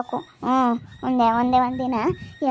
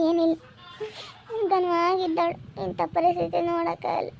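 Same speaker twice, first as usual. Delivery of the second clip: higher-pitched, tearful distress; tearful lament; 4 semitones higher than usual. Real, not acted. Aunt grieving over a hospitalised girl's condition, tearful lament. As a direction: lament through tears, voice pitched up. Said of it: "ಸುಮ್ನಿರು ಅತ್ತೆ, ಅಳ್ಬೇಡ".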